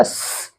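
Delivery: स as a long pure hiss 'sss', no vowel; letter sound. Shown as a demonstration. Teacher shows pupils the sound of S, a held hiss. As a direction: sustain स as a bare s sound. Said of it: "इसका उच्चारण होगा".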